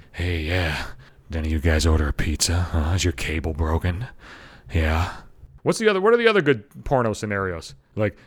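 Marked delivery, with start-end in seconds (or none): 0.1-5.1 very low mock-seductive porn voice; porn actor. Pizza guy turning up in a porn scene, mock-seductive. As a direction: drop way down like a cheesy porn stud. Acts out porn film scenario.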